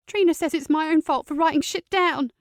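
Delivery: put-on young niece, hurt and tense; teenage girl voice. Teen niece Sophie voiced.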